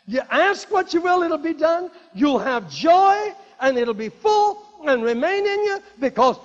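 loud, high, impassioned scripture; preaching at full force. Acted. Preacher proclaiming Jesus' promises to the church, at full force.

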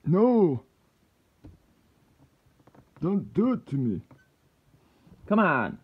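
high, loud protest at the lock; exasperated outcry. Real, not acted. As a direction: cry out in real frustration, pleading with it. Loses his progress picking the lock.

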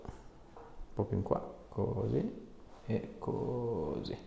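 quiet, slow and calming; soft slow guidance. Real, not acted. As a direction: ease her into position gently. Guiding patient gently before adjustment. (voce bassa e lenta, rassicurante)

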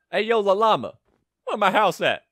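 loud, high playful holler. Pretend. Lost player calling to the llama, playful shout. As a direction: shout over to an animal.

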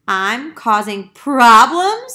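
the duck can't believe it's the problem; mild mock surprise in a duck voice; duck voice, surprised. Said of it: "said the duck".

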